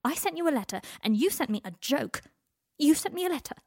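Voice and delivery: heated. Acted. Shouted exchange about letters.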